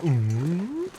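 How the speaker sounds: high hollow moose call; a dopey drawn-out moose "ooh"; moose impression. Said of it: "that is my impression of a moose".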